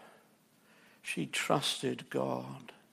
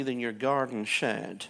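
normal talking voice

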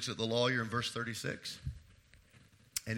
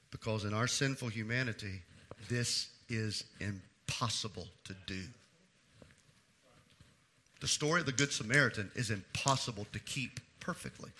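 ordinary talk

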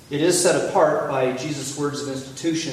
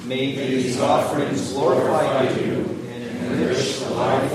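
slow, reverent prayer voice; 4 dB louder than usual. Real, not acted. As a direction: pray it slowly and earnestly. Slow prayer over offering.

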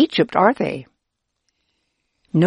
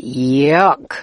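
disgusted; 3 semitones lower than usual. A narrator's reading. Disgust at rotten, wormy leftovers.

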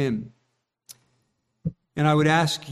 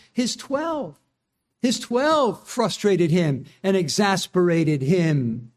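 ordinary talk, nothing marked

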